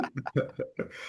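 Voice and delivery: quiet, breath-only real chuckle; small real laugh, mostly air; soft laugh. Real, not acted. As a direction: trail a soft laugh after the words. Laughing at own chaotic beginnings.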